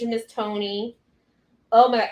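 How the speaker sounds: usual speaking voice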